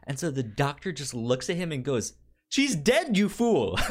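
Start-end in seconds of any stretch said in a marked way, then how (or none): 2.5-3.8 high, loud, laughing mock rebuke; mock exasperated scolding. Retelling the doctor snapping at the cop, laughing high mock scold. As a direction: play the annoyed doctor, cracking up.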